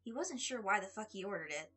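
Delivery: usual speaking voice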